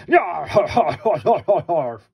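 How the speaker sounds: booming villain's yar-har laugh; loud evil dinosaur laugh; big evil laugh. Acted. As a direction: laugh like a gloating monster. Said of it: "sounded just like a big evil laugh".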